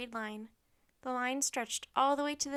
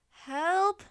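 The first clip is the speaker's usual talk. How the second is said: raised, louder plea; call for help; 7 semitones higher, 10 dB louder than usual. A narrator's reading. Annie stuck in her jacket.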